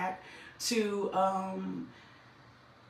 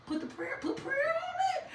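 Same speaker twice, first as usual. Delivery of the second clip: very high, squeezed little voice; strained high squeal; 4 dB quieter than usual. Real, not acted. Preacher urging listeners facing adversity, strained high squeal. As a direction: squeal it, holding back the volume. Hushed squeal before the full shout.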